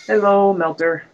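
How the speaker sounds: talking with a pencil in the mouth. Real, not acted. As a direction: say it around a pencil held in the teeth. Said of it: "I got a pencil in my mouth".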